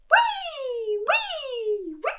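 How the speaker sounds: high whistling wee snore; very high wheezing snore; squeaky snore. Acted. Snoring sound imitated.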